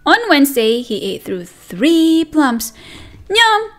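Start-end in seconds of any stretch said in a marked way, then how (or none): none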